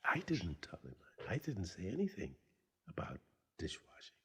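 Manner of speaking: softly whispered disbelief, retold; near-whispered puzzling it over; hushed self-talk. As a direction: say it under your breath to yourself. Puzzled, talking to himself afterwards.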